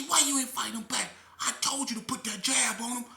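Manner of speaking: loud, high mock outrage; fake angry shout. Confronting his cellmate to pick a fight, loud fake anger. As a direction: yell it like you're furious, but it's a put-on. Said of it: "I'm acting like I'm mad".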